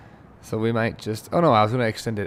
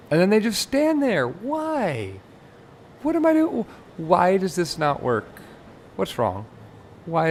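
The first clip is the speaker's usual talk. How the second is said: much higher exasperated whining; frustrated whine; 9 semitones higher than usual. Real, not acted. Gamer frustrated by buggy passengers, high exasperated whine. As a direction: throw up your hands at the game.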